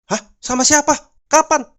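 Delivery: high, loud outraged questions; angry shout. Acted. Husband demanding answers from wife, shouting angrily. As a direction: fire off questions in a high-pitched yell. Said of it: "teriak suaminya marah".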